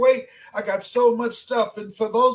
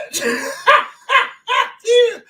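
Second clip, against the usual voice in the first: high laugh; 12 semitones higher, 7 dB louder than usual. Real, not acted. Laughs at his own story.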